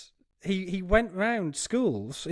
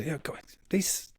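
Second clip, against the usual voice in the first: unvoiced whisper to the staff, slower; hushed aside; 7 dB quieter, slower than usual. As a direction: lean in and whisper it. Hunter quietly tells staff.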